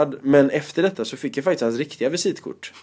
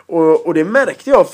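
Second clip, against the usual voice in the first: usual speaking voice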